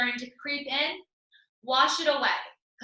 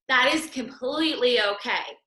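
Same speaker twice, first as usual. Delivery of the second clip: ordinary talk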